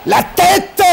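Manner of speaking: high, loud shriek; terrified yell. A narrator's reading. Ship's boy sees the monster's head, terrified shriek. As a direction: yell in terror, at full pitch. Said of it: "hurla le mousse".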